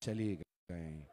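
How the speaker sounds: very soft and slow, barely voiced; whispered into the ear. Sons softly telling their father in his ear. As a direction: lean in and breathe it quietly to the father. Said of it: "कान में कहा".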